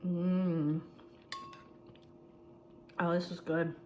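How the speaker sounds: eating while talking; mouth full of hot food. Real, not acted. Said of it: "it just burnt my throat".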